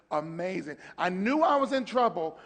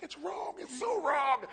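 interpreter's alarm; 9 semitones higher, slower than usual. Retold or imitated. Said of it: "he's like".

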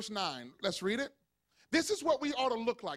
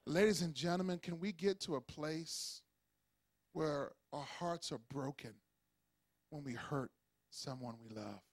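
hushed tender appeal; 9 semitones lower, 8 dB quieter than usual. Acted. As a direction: lower and much softer, heartfelt.

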